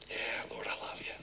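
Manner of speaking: barely audible, humbled answer; whispered confession. Acted. Peter answers Jesus, broken.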